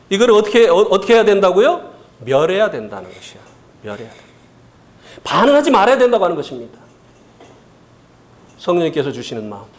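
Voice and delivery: ordinary delivery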